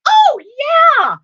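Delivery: loud burst, then high eager chatter; excited young son. Retold or imitated. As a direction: gush like an overexcited chatterbox child. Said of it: "all excited".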